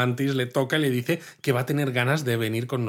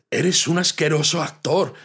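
higher, louder, acting out the disgust; disgusted insult; 5 semitones higher, 4 dB louder than usual. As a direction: spit out the insult like an offended alien. Acted line from the film. (insulto asqueado, más agudo y fuerte)

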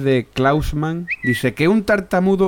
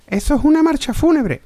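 high voiced reply; 3 dB louder than usual.

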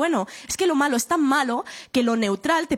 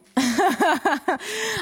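a burst of real, higher laughter; 7 semitones higher than usual. Real, not acted. Telling the interviewer how it ended, real laughter. Laughing at her own story.

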